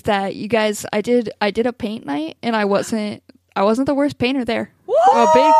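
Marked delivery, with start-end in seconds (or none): 4.9-5.5 high, loud, proud squeal; excited announcement. Acted. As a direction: announce it like a triumph. Announcing own big success.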